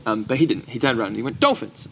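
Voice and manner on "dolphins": high, louder call of dolphins; a bright announcing call. Retold or imitated. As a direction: point them out for the whole cafe to hear. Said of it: "said it loud enough that somebody el".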